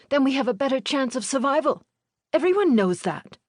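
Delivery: put-on high, insistent teenage boy. A narrator's reading. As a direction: argue like a know-it-all boy. Older boy argues.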